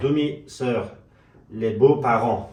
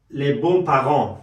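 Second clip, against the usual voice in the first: each French syllable drawn out slowly; slow French demo; 5 dB louder, much slower than usual. Shown as a demonstration. Teacher drilling the French word slowly for the class. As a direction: spell out les bons parents in slow French. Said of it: "و".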